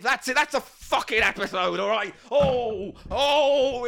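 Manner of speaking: high, hammed-up rage; pantomime rage. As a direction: shake with mock fury. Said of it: "very pantomime, shaking with rage".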